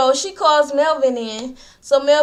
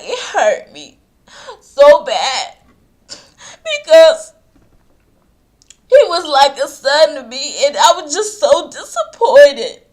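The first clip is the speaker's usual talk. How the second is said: imitating Kandi crying, louder and breaking; half-hammed sob through the words; mock tearful wail; 6 dB louder than usual. Recapping Kandi's tearful confession about Melvin, mock crying. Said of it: "she's crying or whatever".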